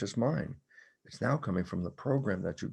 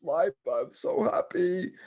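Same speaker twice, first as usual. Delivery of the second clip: high, loud, slow gushing of joy; mock rapturous, drawn out; playing the giddy newlywed, hammed-up; ecstatic lover; 9 semitones higher, 3 dB louder, much slower than usual. Retold newlywed gushing about life.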